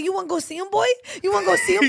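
high, teasing pet-owner voice; talking to a dog. Retold or imitated. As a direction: work the dog up with a question. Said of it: "talking to each other like we're dogs".

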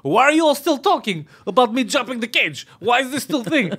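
high-pitched exasperated complaint in broken English; Khabib impression. Fighter at press conference fed up with questions, exasperated. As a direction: whine in protest, pitched well up. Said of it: "Khabib is like".

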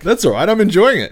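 high, loud, eager reassurance. Real, not acted. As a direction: cheerfully insist it's fine. Reassuring the embarrassed guest.

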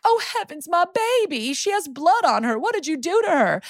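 high, fluttery mother clutching her pearls; hammed-up hysterical mama; fussy Southern mom. Mother fusses over her bloodied daughter at the precinct, high and hysterical.

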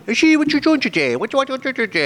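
put-on high, babyish man-child voice. Comic doing Adam Sandler's man-child baby voice. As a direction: coo like an overgrown baby.

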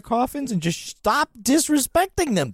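high, slow mock scolding; pleading in mock outrage; mock exasperation.

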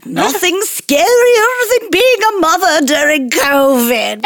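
slow, high creepy Halloween voice; drawn-out witchy spooky voice. Acted. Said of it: "I can't even do a voice. I'm just laughing".

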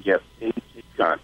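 low, soft and gentle; quiet confirmation. Retold or imitated. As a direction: ease the news softly. Vet confirms the cat is gone.

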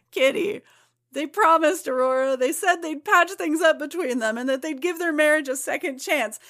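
high, loud melodramatic lament; overwrought teen drama. Acted. Reading Kitty's angsty panel, high loud melodramatic lament. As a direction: ham up the teen heartbreak, building.

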